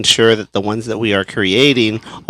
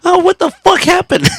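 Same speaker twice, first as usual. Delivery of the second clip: high, louder reenacted dismay; high-pitched acted-out cursing; pained outburst; 5 dB louder than usual. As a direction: cry out in pained disbelief. Retold reaction to the slip.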